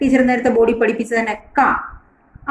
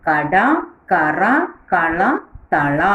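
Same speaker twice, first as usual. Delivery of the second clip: slow word drill; 2 semitones lower, much slower than usual. Shown as a demonstration. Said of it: "teacher reads word list slowly".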